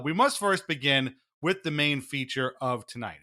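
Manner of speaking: ordinary talk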